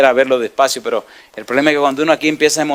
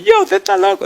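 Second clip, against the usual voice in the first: high-pitched incredulous laugh-line; student laughing at the teacher; laughing disbelief. Retold or imitated. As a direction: toss it off laughing, he thinks you're crazy. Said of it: "Y empezó a reírse".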